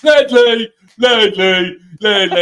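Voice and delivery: loud, high calls of a name across a room; calling out. Pretend. Fan shouting to Ledley in the bar, excited calling.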